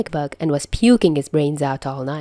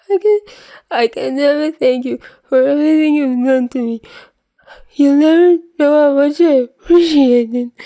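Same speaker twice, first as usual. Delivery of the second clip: tearful wail; 9 semitones higher, 7 dB louder than usual. Acted. Peter still crying his thanks.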